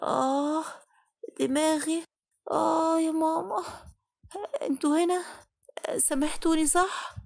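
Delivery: barely awake, asking if they forgave her; weak waking moan.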